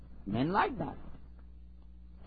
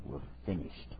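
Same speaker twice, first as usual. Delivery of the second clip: grave warning; 10 semitones lower, 14 dB quieter than usual. Acted. Husband's final warning, acted.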